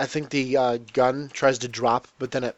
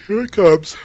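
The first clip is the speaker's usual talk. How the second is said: mid-yawn, louder and higher; 6 semitones higher, 8 dB louder than usual. Real, not acted. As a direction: say it as the yawn takes over. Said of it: "Oh god, I'm yawning".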